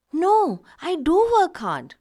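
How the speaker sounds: insist: I do work hard; emphatic denial. Shown as a demonstration. Teacher answering an accuser, stress on do. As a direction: protest with weight on do, voice up. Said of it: "We want to say that, yes, it is like that".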